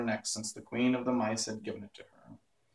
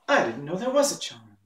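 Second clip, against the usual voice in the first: put-on mildly surprised, higher-pitched girl; a touch of surprise in a raised voice; 5 semitones higher, 4 dB louder than usual. A narrator's reading. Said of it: "answered Dorothy in surprise".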